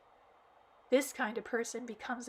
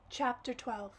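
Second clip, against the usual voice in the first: plain reading voice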